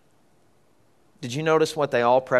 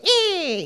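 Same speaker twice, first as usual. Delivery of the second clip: a very high squeaky robot noise; high robot squeal. Retold or imitated. Preacher imitating a toy robot head popping up, high squeal. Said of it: "his head goes".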